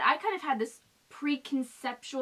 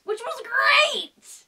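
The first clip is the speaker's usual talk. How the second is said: drawn-out excited; 12 semitones higher, 7 dB louder, much slower than usual. Real, not acted. Stretched enthusiasm.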